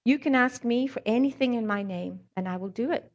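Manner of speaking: usual speaking voice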